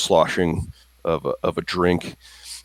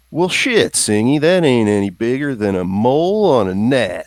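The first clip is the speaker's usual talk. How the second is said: put-on high, twangy country-boy voice, a touch amused; loud, high-pitched country quip; Slim's country voice; 5 semitones higher, 4 dB louder than usual. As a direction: play a folksy cowhand teasing his friend. Player voices Slim, country drawl.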